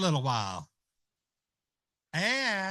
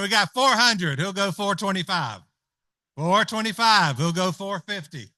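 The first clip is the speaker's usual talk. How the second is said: louder, livelier auction call; auctioneer calling bids; 6 dB louder than usual. Acted. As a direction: push the bidding up with energy.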